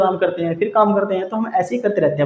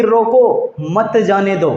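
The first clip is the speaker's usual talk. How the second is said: slow, firm रोको, break, then मत जाने दो; pause after रोको; 2 semitones higher, slower than usual. Shown as a demonstration. Telling someone to stop a person leaving, pause after रोको. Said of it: "कुछ विराम दिया एक शब्द के बाद में".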